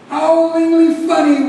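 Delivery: high, loud roar of hilarity; theatrical, full-voiced roar. A narrator's reading. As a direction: roar it out, pitched right up. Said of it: "we roared".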